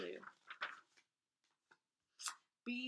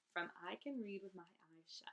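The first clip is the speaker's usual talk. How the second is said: soft whispered aside; 2 semitones lower, 7 dB quieter than usual. Shown as a demonstration. Reader whispers the book title after the quote, hushed. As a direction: breathe the title like a secret.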